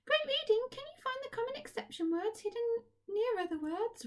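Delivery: very high, squeaky puppet voice full of cheer; a chirpy puppet praising the children; Kathy puppet voice. Acted. To the class, puppet Kathy cheers them on, squeaky and high.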